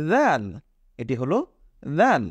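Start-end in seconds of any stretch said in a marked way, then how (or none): 0.1-0.4 দাল pronounced high and loud as the dotted letter; dotted letter name, high and loud. Shown as a demonstration. Teacher revealing the dotted letter দাল to students, high and loud. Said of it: "নিশ্চয়ই এটি".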